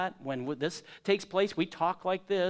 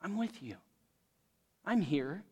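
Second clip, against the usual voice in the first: softer, hushed, reassuring promise; God speaking tenderly; 8 dB quieter than usual. Acted. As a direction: gently assure the lost listener. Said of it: "God comes in his grace and says".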